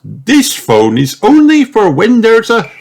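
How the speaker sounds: high, loud cartoon shout. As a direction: yell it out, rising to the last word. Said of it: "shouts Daddy Pig".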